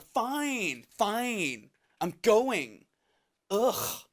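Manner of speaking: grudging mock-exasperated surrender; imitated angry huff. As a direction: huff it out, hammed-up annoyance. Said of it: "she's angry".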